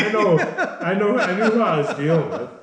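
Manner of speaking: laughing through the words, pitch up high; giggling admission. Real, not acted. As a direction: caught out, can't stop laughing. Laughs at client's confession.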